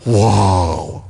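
low, drawn-out whoa; mock amazement, hushed and impressed; awed onlooker. Onlookers hearing a showy prayer, mock awe. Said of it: "people around them are going".

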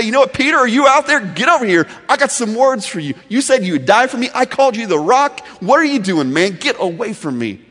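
loud, high, angry rebuke; bringing the hammer. Pretend. Jesus scolding Peter for his denial, loud and angry. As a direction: tear into Peter. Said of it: "he'd wanna bring the hammer".

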